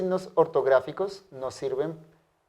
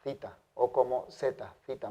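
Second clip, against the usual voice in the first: ordinary talk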